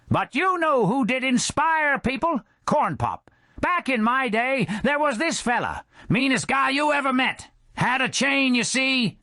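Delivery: high fast put-on voice, building to a loud finish; rambling old-timer. Skeleton Joe reminiscing to the bar about Corn Pop. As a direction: spin the yarn with gusto.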